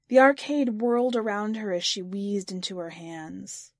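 ordinary delivery

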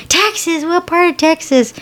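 nosy Texan. Retold or imitated. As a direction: mimic a tipsy stranger prying. Dallas drunks quiz the visitors.

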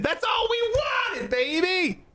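high, slow triumphant shout; excited shout. Real, not acted. Fan gloats about his team's season, high triumphant shout. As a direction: belt it out like a thrilled fan.

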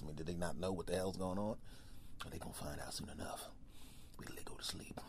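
low, fast whisper; secret thoughts. Acted. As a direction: whisper a sneaky plan. Bear answers lion, plotting.

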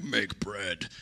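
very deep devil rumble; devil's voice. Shown as a demonstration. Pastor showing the temptation isn't scary, in a deep devil voice. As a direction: drop way down into a sinister devil voice. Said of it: "even if I said it in the devil's voice".

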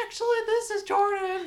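very high, loud squeaky phone voice; put-on piping child's voice correcting a caller; high-pitched younger voice. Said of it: "I had a very high-pitched voice".

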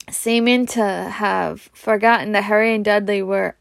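plain reading voice

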